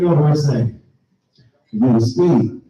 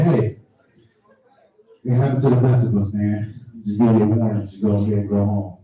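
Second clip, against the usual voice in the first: reassuring officer; 7 semitones lower than usual. Retold or imitated. Officer lets him off.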